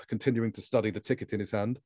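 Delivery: ordinary talk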